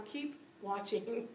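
soft, slow, patient hush; calm quiet God. Retold or imitated. God answering her snark, soft and slow. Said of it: "He's going".

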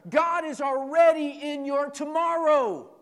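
repeated as a shouted, slow climax; loud slow repeat. Shown as a demonstration. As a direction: hammer the line home slowly and loudly. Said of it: "Say it again, Pastor".

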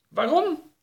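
high-pitched call; high voice of a chased woman; fleeing woman's cry. Acted. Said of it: "riep de vrouw al rennend".

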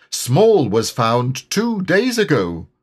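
high, slow storybook announcement; Rabbit. A narrator's reading. Rabbit tells Eeyore that Small was found, high Rabbit voice. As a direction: lift the pitch and spell out the news slowly.

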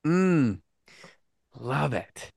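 normal talking voice

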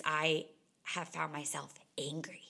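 normal talking voice

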